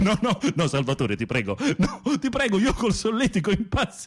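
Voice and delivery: high, laughing no, no, no; tickled pleading. Real, not acted. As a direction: beg through the tickling. Being tickled, begging.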